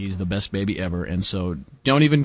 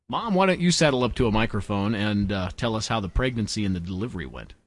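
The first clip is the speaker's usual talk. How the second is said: ordinary talk, nothing marked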